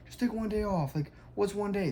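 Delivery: pushy teammates. Retold or imitated. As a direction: imitate buddies nagging him to skip. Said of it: "they get mad at me, like".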